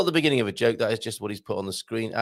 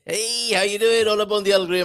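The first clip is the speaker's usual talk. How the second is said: hammed-up greeting; 9 semitones higher, 4 dB louder than usual. Host's hammed-up greeting to viewers.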